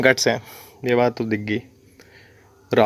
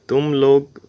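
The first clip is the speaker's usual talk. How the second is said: normal talking voice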